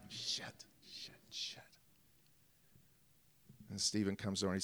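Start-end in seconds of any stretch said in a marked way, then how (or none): 0.1-1.6 muttered shits under the breath; whispered curse. Real, not acted. Actor cursing under his breath after the blown take. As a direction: curse to yourself so no one hears.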